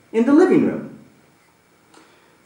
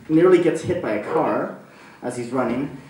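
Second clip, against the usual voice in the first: usual speaking voice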